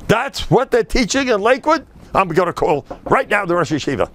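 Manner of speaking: high outraged question; the husband fuming, ready to call; indignant husband. Retold or imitated. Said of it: "the husband".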